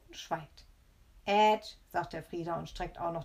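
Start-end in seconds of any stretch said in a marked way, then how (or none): 1.3-1.8 loud, cheeky nyah-nyah; bratty, loud jeer; gloating taunt. Acted. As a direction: gloat like a naughty child. Boy gloats at grandma.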